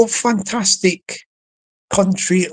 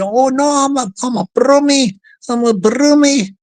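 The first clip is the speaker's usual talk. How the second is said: higher, badly faked Brummie accent; 5 semitones higher, 3 dB louder than usual. Shown as a demonstration. Imitating a touchy Brummie correcting someone, in Brummie accent. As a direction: protest in a put-on Brummie voice. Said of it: "I bet it don't sound nothing like a Brummie".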